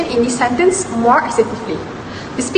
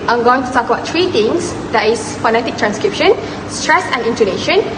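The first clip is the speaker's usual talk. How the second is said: usual speaking voice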